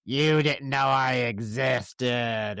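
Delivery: slow, menacing demon. Retold or imitated. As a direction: drag it out like a lurking demon. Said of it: "She's full demon".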